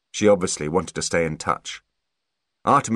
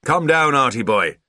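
raised, hearty call falling away; father's shout; 7 semitones higher, 5 dB louder than usual. A narrator's reading. Father shouting for his son to come down, raised voice. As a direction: shout up the stairs to your boy. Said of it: "shouted his father".

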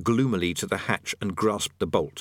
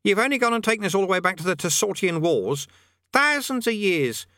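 put-on high, reproachful boy; accusing boy; 11 semitones higher than usual. A narrator's reading. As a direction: scold like a know-it-all kid. Eric complains to Rincewind.

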